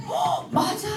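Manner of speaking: high, hammed-up gasp of surprise; mock surprise. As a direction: act delighted and amazed at the child. Mother reacts to daughter's answer. (딸의 대답에 과장되게 놀라며)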